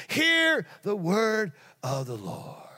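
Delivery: slow, drawn out, fading to a hush; solemn proclamation. Acted. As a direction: start high and let it die away. Climax of the prophecy fading.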